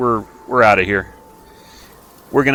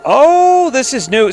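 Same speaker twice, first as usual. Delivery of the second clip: surprised; 6 dB louder, slower than usual. Real, not acted. Notices new game feature.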